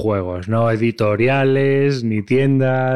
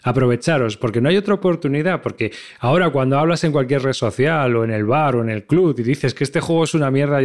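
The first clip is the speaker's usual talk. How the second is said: ordinary delivery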